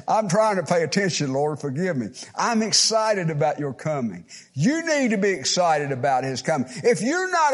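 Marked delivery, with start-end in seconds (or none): none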